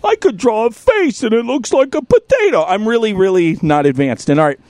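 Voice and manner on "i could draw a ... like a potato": put-on high, whiny little-kid voice; self-mocking kid voice, high; mock childish voice. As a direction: pitch it up like a dopey kid. Voicing himself as childlike, mocking.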